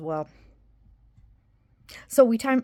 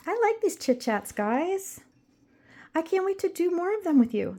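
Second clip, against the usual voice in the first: cheery voice; 9 semitones higher than usual. Acted. Cheery sign-off to viewers.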